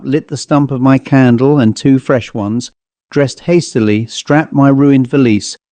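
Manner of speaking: plain reading voice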